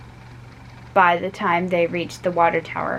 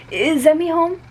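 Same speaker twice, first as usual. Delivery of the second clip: put-on boy's voice; 9 semitones higher, 3 dB louder than usual. A narrator's reading. Johnny asks the mother.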